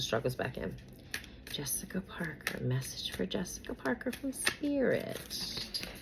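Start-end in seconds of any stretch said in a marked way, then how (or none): 1.5-5.9 whispered incantation. Real, not acted. Reader whispers the celebrity's name over the tarot deck. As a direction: whisper the name, much softer.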